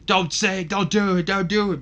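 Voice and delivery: high, loud begging; pleading scream. Retold or imitated. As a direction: beg him not to give in. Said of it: "Benoit is screaming the entire time".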